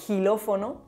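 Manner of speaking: "gilófono" with a throaty j sound at the start. Shown as a demonstration. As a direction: say "gilófono" with a Spanish j. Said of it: "como j".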